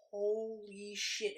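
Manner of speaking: drawn-out, stunned "Holy shit"; slow awed reaction. Retold or imitated. Viewer reacting to the barefoot scene, slow retold awe.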